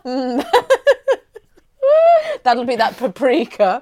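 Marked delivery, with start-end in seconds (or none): 1.8-2.4 squealing helpless laugh; high laughing cry. Real, not acted. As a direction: shriek with laughter.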